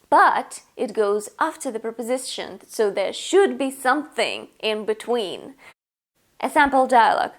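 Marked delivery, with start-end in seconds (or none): none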